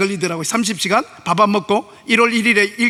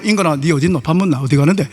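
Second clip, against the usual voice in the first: lightly lowered, teasing God in dialect; a joking God checking on dinner; mock God voice; 6 semitones lower than usual. Students imagining God talking to the pastor, mock deep. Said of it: "하나님이 직접 나타나셔서 말해요".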